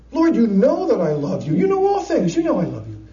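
Peter pleading, pitch jumping on all things; troubled pleading. Acted. Peter pleads with Jesus, troubled and insistent. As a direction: earnestly insist on your love, then settle on feed my sheep. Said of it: "He's troubled".

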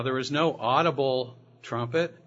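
normal talking voice

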